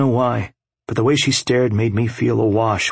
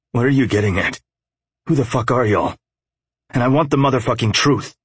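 rising, heated demand for answers; anger taking over, pitch climbing; angry; 5 semitones higher than usual. A narrator's reading. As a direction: build from testy question to higher, angry demand. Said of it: "My anger was in the driving seat".

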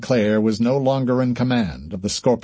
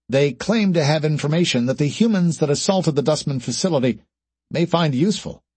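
higher-pitched junior officer's report; formal ship's report, pitched up; communications officer; 4 semitones higher than usual. Acted. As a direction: report to the captain in a raised voice.

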